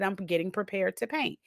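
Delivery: hushed, eager under-the-breath whisper; soft giddy whisper before starting a job; excited whisper. Retold or imitated. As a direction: whisper it like a secret thrill.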